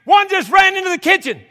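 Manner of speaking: high, loud mock wife scream; screamed alarm. Wife telling husband rat ran into kitchen, screamed. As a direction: imitate a wife hollering in alarm. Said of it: "She screamed".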